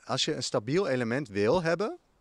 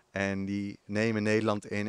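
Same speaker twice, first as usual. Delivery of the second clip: ordinary talk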